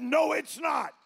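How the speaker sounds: loud, high proclamation; voice of God. Acted. God answering the pastor, loud commanding voice. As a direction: declare it with total authority. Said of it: "God broke the silence and said".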